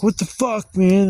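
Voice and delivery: high, loud outraged cry; shocked yell. Retold or imitated. Bitten by the pit bull, shouting in shock. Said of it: "I'm like".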